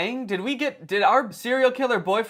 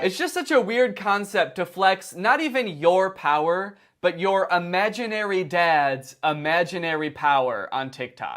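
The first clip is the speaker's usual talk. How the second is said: ordinary delivery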